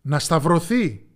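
loud, slow demand; the crowd cries out against Jesus, loud and drawn out; crowd shouting. A narrator's reading. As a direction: bellow it like a mob wanting blood. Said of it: "Και το πλήθος φώναξε".

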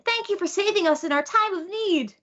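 high, earnest cute-creature voice; grateful little babies. Retold or imitated.

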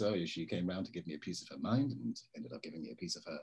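smug crude boast, slightly put on; a hint of Johnson's leering brag; imitating Johnson. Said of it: "and says".

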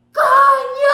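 re-enacted high, full-force yell; desperate shout. Child at school losing patience with screaming classmates, desperate shout. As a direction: yell it out like an exasperated little kid. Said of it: "y grité".

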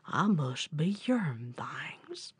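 slow, low, whispery bear voice; puzzled bear. Acted. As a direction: mull it over low and drawn out. Slow bear's reply in story.

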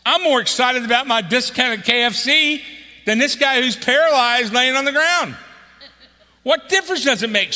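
loud, high fervent preaching; preaching at full force. Acted. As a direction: drive the point home to the congregation.

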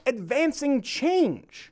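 slow, drawn-out mocking quote; sarcastic air quotes. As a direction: sneer at the phrase.

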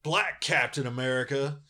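high, mocking sales pitch; sarcastic pitchman voice; mocking Marvel. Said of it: "Marvel's been doing this thing".

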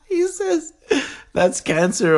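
talking through laughter; words broken by laughs; laughing. Real, not acted. Still laughing.

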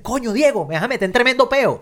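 high, exasperated Caracas street slang; Venezuelan exclamation. Retold or imitated. Doctor protesting to Diego, high exasperated Venezuelan slang. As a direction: blurt it out like a Venezuelan doctor cornered by a friend. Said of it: "una expresión venezolana que me dio mucha risa".

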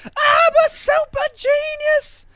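very high, drawn-out Kel yell; losing it. Acted. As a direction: scream it out slowly, wildly high. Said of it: "and then Kel loses it".

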